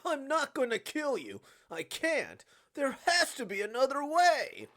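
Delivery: high, loud desperate protest; anguished refusal. Acted. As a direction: shout the refusal, pleading for another option. Acted refusal to kill her.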